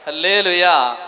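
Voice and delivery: loud, ringing hallelujah. Shown as a demonstration. Preacher calls the congregation to shout hallelujah. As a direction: call it out for all to hear. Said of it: "ഉച്ചത്തിൽ പറഞ്ഞേ".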